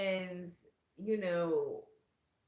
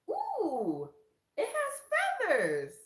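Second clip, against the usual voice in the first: delighted squeal, much higher, louder and slow; high excited coo; 8 dB louder, much slower than usual. Retold or imitated. Sister squealing at her shawl, high and delighted. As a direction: coo over the feathers.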